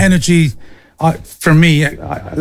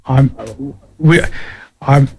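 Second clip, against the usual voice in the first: stumbling over the first words; halting restarts, tongue-tied; stuttering. Real, not acted. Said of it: "I'm stuttering and spluttering here".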